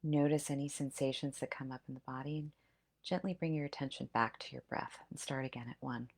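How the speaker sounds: soft, hushed meditation voice; guided breath meditation. Acted. Leads breath-counting meditation.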